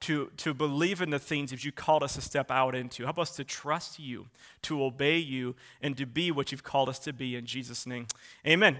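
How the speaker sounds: earnest low-key prayer. Real, not acted. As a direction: pray quietly on behalf of the congregation. Leads a closing prayer.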